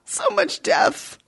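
high, slow mock wail of grief; mock anguish. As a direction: wail it in hammed-up despair. Dismayed at the character's death.

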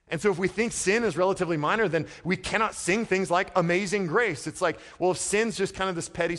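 loud, high, urgent preaching; impassioned sermon voice; full-force preaching. Acted. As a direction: preach it out to the back row.

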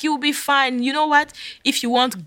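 high-pitched imitation of the pastor; high, placating retold voice; reassuring pastor. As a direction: lift the voice high to placate her. Pastor reassuring her, acted.

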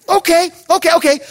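high-pitched, acted okay-okay; strained patience. Pastor hearing the kid is still stuck, high strained patience.

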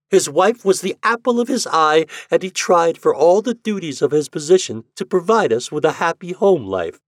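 high, loud sarcastic list; mock the fond memories. A narrator's reading. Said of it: "her voice mocking".

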